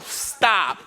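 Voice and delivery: low, dead-stop realization; deadpan dismay. Retold or imitated. Driver spotting the dragging pump in his mirror, deadpan dismay. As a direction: let it drop low on the one word.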